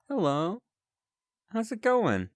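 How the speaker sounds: chirpy friendly character; high cheerful voice. Acted.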